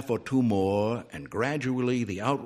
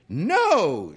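loud, high outraged shout; indignant matron's high cry; shouted refusal; 8 semitones higher, 7 dB louder than usual. A narrator's reading. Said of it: "shouted Missus Dillway".

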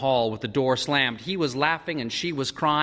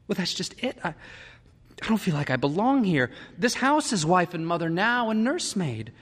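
higher, pleading woman's voice; distressed wife; 9 semitones higher than usual. A narrator's reading. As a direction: plead from feeling useless. Lydia pleading about the house.

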